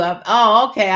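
ordinary talk

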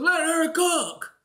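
slow, drawn-out sarcastic Let her cook; mocking catchphrase. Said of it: "Like, tell some".

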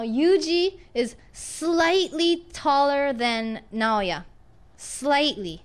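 slow, drawn-out example with slightly; slow model sentence. Shown as a demonstration. Teacher slowly models slightly for the class. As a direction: stretch it out so students catch slightly. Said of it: "slow teaching example".

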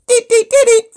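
short, very high, loud beeps; imitated wrist buzzer. Reader mimicking the vibration on the wrist, high loud beeps. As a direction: beep like an alert, evenly repeated. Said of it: "Money vibrating on my wrist".

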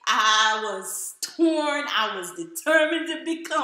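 ordinary talk

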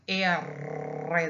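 R said as Spanish "erre" with a long trill; rolled double R. Shown as a demonstration. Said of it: "roll one that goes round".

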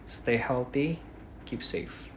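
usual speaking voice

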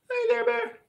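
very high, tiny cutesy voice; squeaky greeting. Pretend. Streamer greets a viewer in a squeaky high voice. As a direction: squeak it out like a cartoon critter.

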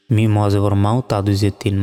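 ordinary delivery

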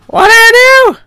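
very high, loud shriek; loud high-pitched outcry; shrieked protest. Real, not acted. Panicked reaction to game mishap.

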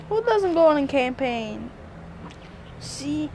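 usual speaking voice

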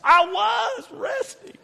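very high, drawn-out whine; mock exasperated protest. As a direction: wail it slowly at the wife. Acts exasperated reply to wife.

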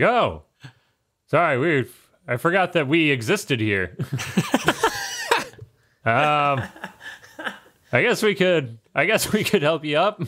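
sorry we forgot you, sheepish; sheepish diving judges. Acted.

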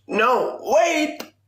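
shrill high-pitched "No, wait!"; mock panicked English. Shown as a demonstration. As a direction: squeal it like a cartoon panic. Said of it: "in English".

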